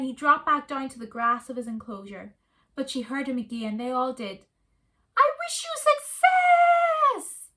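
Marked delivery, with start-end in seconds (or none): 5.2-7.5 very high, slow ringing meerkat call. A narrator's reading. Meerkat Kevin calling after the kids, high and slow. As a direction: send the wish after them, drawn out.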